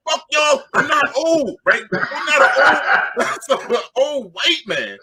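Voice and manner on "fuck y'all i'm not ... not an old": high indignant mock yell; yelling at the crowd. Storyteller yelling back at teenagers, high mock yell. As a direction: throw it back at the crowd, offended. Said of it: "And I'm yelling at the crowd".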